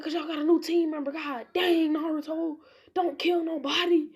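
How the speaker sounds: high, loud, exasperated plea; exasperated scolding. Acted. Hokage scolds Naruto for nearly killing.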